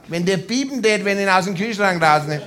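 ordinary delivery, nothing marked

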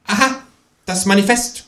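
very high, smug kangaroo remark; know-it-all. A narrator's reading. Kangaroo smugly names the book to the ghost. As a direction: state it like a smart-aleck. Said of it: "konstatiert das Känguru altklug".

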